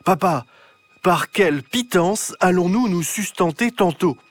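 very loud voice. Acted. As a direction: announce it grandly to dad. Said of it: "d'une voix très forte".

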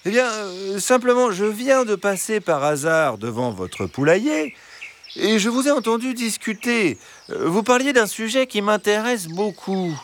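very high, sweet-talking fox voice; gentle fox. Acted. Fox explaining why he stopped by the henhouse, gentle.

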